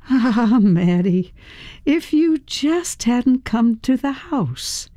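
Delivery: slow, high, drawn-out lament; regretful gunwoman. A narrator's reading. Joan to Maddie at gunpoint, slow regretful menace.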